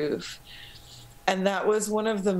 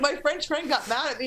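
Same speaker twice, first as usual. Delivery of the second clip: usual speaking voice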